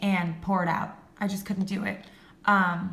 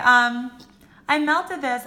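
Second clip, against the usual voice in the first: normal talking voice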